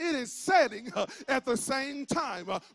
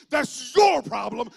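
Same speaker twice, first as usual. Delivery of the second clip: YOUR stretched out and much louder; stress on YOUR; 9 dB louder than usual. Real, not acted. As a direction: lean hard and loud on "your", slowly. Defiant punchline on acceptance.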